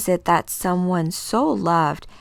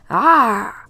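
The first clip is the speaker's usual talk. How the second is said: sharp startled cry; 4 semitones higher, 8 dB louder than usual. Acted.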